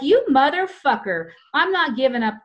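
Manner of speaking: retelling how she stood up to the cop, loud; defiant retort. Retold or imitated. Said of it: "And I was like".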